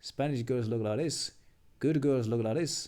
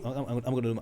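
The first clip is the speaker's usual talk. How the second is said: ordinary talk, nothing marked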